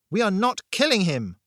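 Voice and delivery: loud, high, fierce objection; emphatic angry outburst; barked refusal. A narrator's reading. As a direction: bark it out, allowing no argument. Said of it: "I barked".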